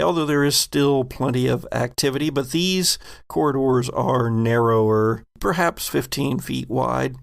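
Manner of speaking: ordinary talk, nothing marked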